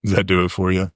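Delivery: low, seductive crooner voice; deep mock lover's croon.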